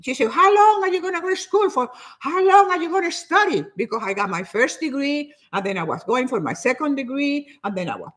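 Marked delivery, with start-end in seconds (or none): 0.3-3.6 nagging grandmother. Retold or imitated. Speaker quoting grandmother about endless schooling, nagging. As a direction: grill them, imitating an exasperated elder.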